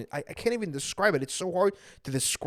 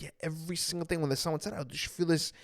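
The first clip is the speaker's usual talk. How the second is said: hushed quick confiding; 8 dB quieter, faster than usual. Retold or imitated. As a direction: murmur it fast. Brother describing sunset sadness.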